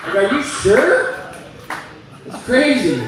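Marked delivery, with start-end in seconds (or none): 0.2-1.2 doubtful question. Acted. Disbelieving husband quote.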